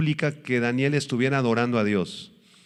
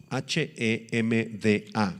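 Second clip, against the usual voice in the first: ordinary talk, nothing marked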